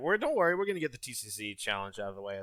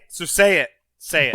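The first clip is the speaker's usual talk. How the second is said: pushy urging; 6 semitones higher, 12 dB louder than usual. Real, not acted. Urging co-host loudly.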